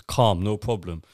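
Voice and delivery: ordinary talk, nothing marked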